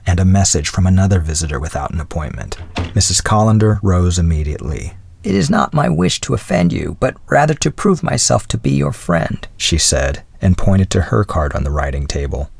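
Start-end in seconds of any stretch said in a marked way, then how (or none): 5.2-9.5 put-on lightly raised voice of a gracious old lady; a hint of the old widow's voice; elderly lady voice. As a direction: speak as a kindly older woman. Narrator voices Mrs Callender.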